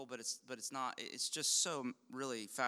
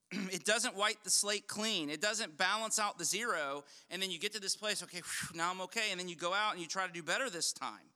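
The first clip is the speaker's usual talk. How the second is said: raised, ringing pulpit voice; full-force preaching; 6 semitones higher, 5 dB louder than usual. Acted. Pastor pressing his congregation on the gospel, fervent preaching. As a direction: preach it out to the back row.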